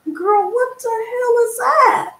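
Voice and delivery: high, slow, stunned outburst; shocked. Real, not acted. Host reacting in disbelief to a viewer's comment, stunned. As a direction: drop the act and react in disbelief. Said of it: "come out of my girly voice".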